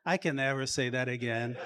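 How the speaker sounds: quick self-mocking aside under the breath; muttered private realization; inner thought. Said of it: "I'm thinking".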